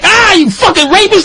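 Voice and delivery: high-pitched mock accusation; mock jeering. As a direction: jeer it way up high. Inmates' imagined jeering, acted out.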